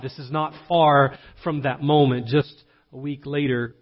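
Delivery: ordinary talk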